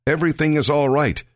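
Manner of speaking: high-pitched call at normal volume; restrained narrated cry to a king. A narrator's reading. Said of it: "cried out to the king".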